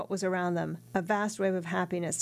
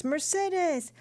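happy call from outside; 11 semitones higher than usual. Acted. Returning sailor calling his sweetheart from outside, joyful high call. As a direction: call out to her from beyond the door, overjoyed. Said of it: "cried a happy voice outside the house".

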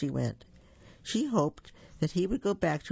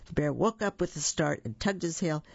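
ordinary delivery, nothing marked

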